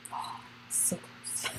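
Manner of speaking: breathless near-silent whisper. Real, not acted. Wistful aside to viewers about nearly calling dad, whispered. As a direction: let it slip out under your breath.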